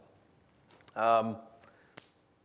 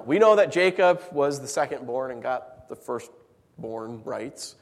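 usual speaking voice

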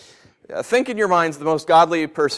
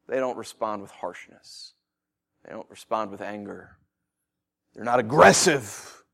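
usual speaking voice

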